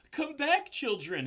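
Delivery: loud, high desperate call; frantic pig calling; crying out. A narrator's reading. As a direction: cry out to the leaving babies. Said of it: "he cried".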